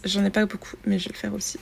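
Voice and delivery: ordinary talk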